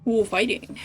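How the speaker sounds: ordinary talk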